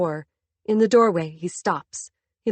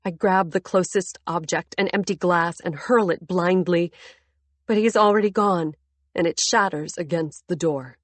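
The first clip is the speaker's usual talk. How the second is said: ordinary delivery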